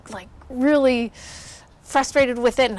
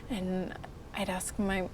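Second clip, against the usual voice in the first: ordinary talk, nothing marked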